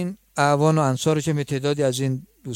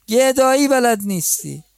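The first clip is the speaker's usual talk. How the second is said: chiding; 8 semitones higher than usual. Acted. Continues the scolding.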